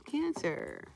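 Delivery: ordinary talk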